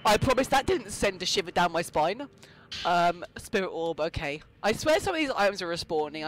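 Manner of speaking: ordinary talk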